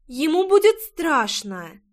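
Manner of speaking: put-on high, slow childish objection; a small girl pleading for her cat; little girl protesting. A narrator's reading. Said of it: "возразила девочка". (детский голос, возражение)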